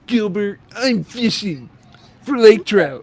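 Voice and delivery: hammed-up character voice. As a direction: overdo the line in a high, put-on voice. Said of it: "Don't do it like that".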